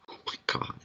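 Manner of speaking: breathy whispered aside; a hushed oh my God. Real, not acted. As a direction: whisper it under your breath. Whispered aside after laughing.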